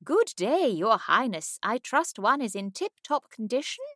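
high, loud mock-posh English accent. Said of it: "poke fun at her own posh English accent".

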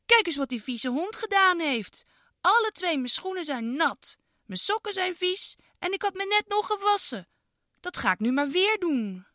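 put-on high childlike voice, indignant; a girl whining about wet socks; girl Iris complaining. Girl complains to her mum, high indignant child voice.

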